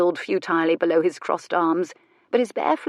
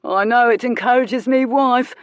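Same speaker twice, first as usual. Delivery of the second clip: higher, louder working-class voice, joking; Cockney workman joking; 5 semitones higher, 6 dB louder than usual. Acted. Workman in boots joking to the crowd, cheeky Cockney voice. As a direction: crack a joke about the wife. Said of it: "one of them in big worn boots said".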